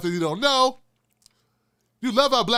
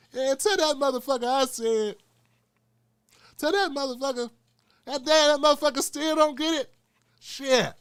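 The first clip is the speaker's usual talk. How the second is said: higher, rowdy raspy-free cussing; Richard Pryor impression; 6 semitones higher than usual. Richard Pryor yelling to pass a message to Tim. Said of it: "I'm here with Richard".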